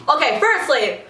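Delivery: usual speaking voice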